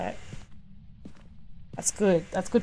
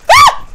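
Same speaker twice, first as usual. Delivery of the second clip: sudden high shriek of fright; startled real scream; 16 dB louder than usual. Real, not acted. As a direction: shriek as the ghost jumps out. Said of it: "I actually screamed".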